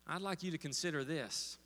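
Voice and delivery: ordinary talk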